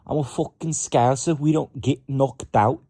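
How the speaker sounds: cocky Liverpool fighter boast, hammed-up accent; Scouse accent attempt. Retrying Luke Riley as a Scouser, loud mock accent. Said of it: "trying to do it in a British accent".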